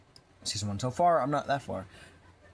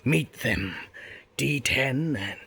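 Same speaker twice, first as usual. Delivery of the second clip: louder, slower hammed-up villain chat; playful character voices; 3 semitones higher, 4 dB louder, slower than usual. Villain introducing new minions, hammed-up. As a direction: ham up a villain and his minions.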